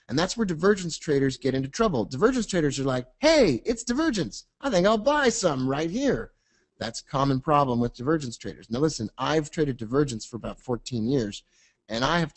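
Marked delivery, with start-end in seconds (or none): 3.2-6.3 high naive voice; mocking eager trader. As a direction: play the overeager trader, mockingly.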